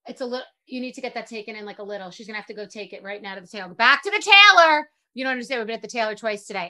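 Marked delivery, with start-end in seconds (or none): 3.7-4.9 loud, high mock announcement; exclaiming. Host announcing yet another tailor trip, loud exclamation. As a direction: belt it out in exasperation.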